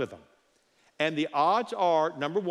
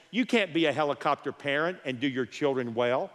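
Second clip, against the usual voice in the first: normal talking voice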